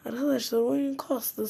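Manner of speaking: angry complaint. Real, not acted. Gamer angrily telling viewers the game lagged. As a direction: complain hotly about the lag. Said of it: "çok sinirlendim".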